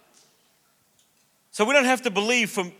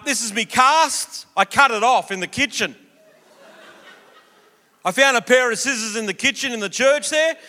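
higher, louder, laid-back Aussie bloke; Aussie footy bloke; 4 semitones higher, 4 dB louder than usual. Retold or imitated. Healed footy player thanks the youth group at the mic, broad and cheerful.

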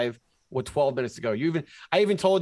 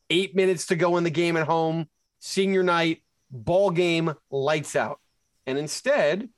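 normal talking voice

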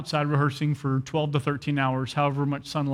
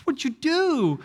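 much higher, stunned question; shocked; 10 semitones higher, much slower than usual. Retold or imitated. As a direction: blurt it out in disbelief. Shocked at the beating news.

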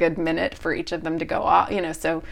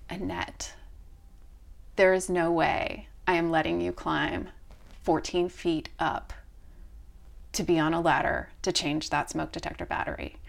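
softer, steady no; quiet firm refusal; 3 semitones lower, 6 dB quieter than usual. Retold or imitated. Wife forbidding the ladder climb, quietly firm. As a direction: calmly forbid it. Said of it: "And she's like".

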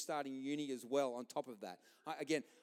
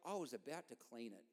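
put-on lower, softer teenage grumble; sulky son; 4 semitones lower, 4 dB quieter than usual. Son answering his dad, low and sulky. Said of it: "He's like".

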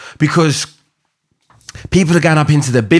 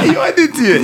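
laughing. Real, not acted. Laughing at friend's joke.

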